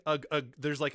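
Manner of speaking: normal talking voice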